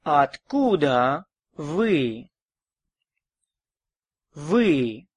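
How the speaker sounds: ordinary talk, nothing marked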